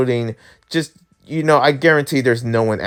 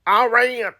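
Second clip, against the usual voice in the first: high, exaggerated American "Iran"; 9 semitones higher than usual. Shown as a demonstration. Playing up his American side, hammed-up American accent. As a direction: ham up "Iran" as an American eye-RAY-un. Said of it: "say it in the American way".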